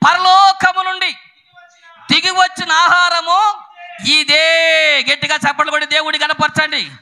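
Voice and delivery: high, loud, ringing sermon delivery; high-pitched rallying preacher; full-force preaching. Real, not acted. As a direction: proclaim it to the congregation, drawing out key words.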